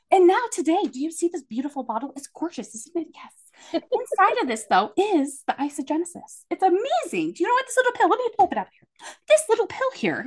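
high, hammed-up infomercial pitch; crazy sales mode. Saleswoman pitches to her husband on the couch, in hammed-up crazy mode. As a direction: sell the pill like a manic host. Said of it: "crazy mode".

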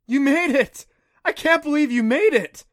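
high, loud joyful cry; overjoyed. Acted. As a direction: burst out with relief. Friend returns alive.

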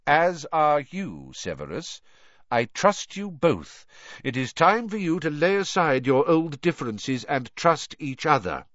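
emphatic leader with a lifted voice; authoritative headmaster. Acted. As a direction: urge two rivals to make peace.

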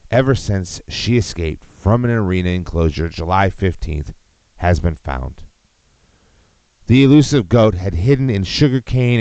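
reading the article in a mock newscaster voice; news read. Streamer reading a goat story to viewers, mock newscaster. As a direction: ham up the news report, then break off.

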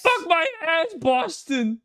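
high, loud hammed-up Massachusetts shout; mock Boston fan yell. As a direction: scream it like a rowdy Boston fan.

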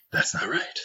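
breathy whisper; whispered aside. Shown as a demonstration. Host's demo aside, whispered under his breath. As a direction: whisper it to yourself. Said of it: "that little whisper talk".

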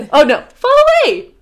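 high, loud, breezy answer; cheerful reply. Acted. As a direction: toss it off cheerfully. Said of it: "Esteban answered cheerfully".

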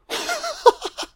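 high-pitched laughing fit; real laughter. Real, not acted. Viewer mocking the film's bonding scene, laughing high.

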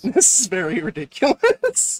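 slow, amused laugh through words; chuckling in disbelief. Real, not acted. Laughing at the audio mess.

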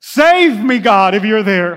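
high, loud slow plea to heaven; preacher acting out a loud cry for help; crying out to God. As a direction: call upward like a desperate prayer. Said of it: "lift up your eyes and say".

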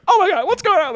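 high, loud mock panic; freaking out. Teller as mom who has no clue, mock freak-out. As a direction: imitate an alarmed mother shrieking. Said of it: "My mom freaking out".

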